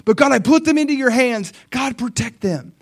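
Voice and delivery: rushed, high, loud plea; urgent acted prayer, pitched high; crying out. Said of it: "He's just, like, crying out".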